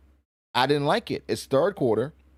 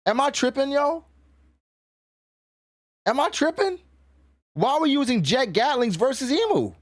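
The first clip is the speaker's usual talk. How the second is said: incredulous rant; 11 semitones higher than usual. Real, not acted. Ranting at Luffy's choices.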